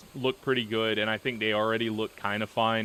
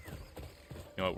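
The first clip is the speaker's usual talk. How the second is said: whispering; 2 semitones lower, 13 dB quieter, slower than usual. Real, not acted. Quiet aside to the horse.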